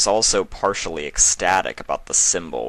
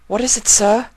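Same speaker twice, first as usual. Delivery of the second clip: eager question; 8 dB louder than usual. A narrator's reading. Colleagues urge leader.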